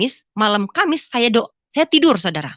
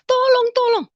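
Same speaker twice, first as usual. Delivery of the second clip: high, wailing plea to God; menangis minta tolong. Retold or imitated. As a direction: re-enact crying out for rescue. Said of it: "Saya menangis". (meratap minta tolong dengan nada tinggi)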